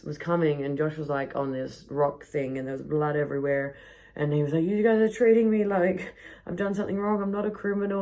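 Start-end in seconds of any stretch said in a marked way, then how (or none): 4.6-8.0 mock drunk protest. Drunk friend protesting innocence.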